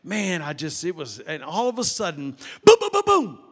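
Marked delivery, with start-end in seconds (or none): 2.6-3.4 loud, high booming knocks; imitated banging. Telling church about a sudden sound, loud mock banging. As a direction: boom it out like sudden pounding on a door. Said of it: "I heard that sound".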